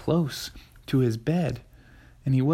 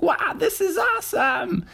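put-on excited kid voice. A narrator's reading. Froggy delighted on the bed.

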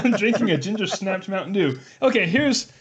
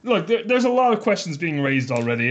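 ordinary talk

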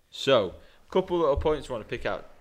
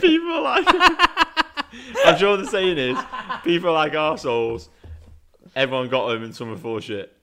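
squealing high with laughter, loud; laughing; 5 semitones higher, 8 dB louder than usual. Real, not acted. Mate laughing while misquoting the saying. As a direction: giggle through the saying.

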